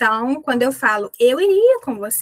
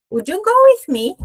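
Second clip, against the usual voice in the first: higher question; 7 semitones higher, 5 dB louder than usual. Shown as a demonstration. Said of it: "vou perguntar com would you".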